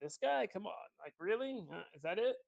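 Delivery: high, incredulous scoffing; quizzical. Acted. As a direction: scoff at him in disbelief. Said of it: "you just kinda quizzically look at him".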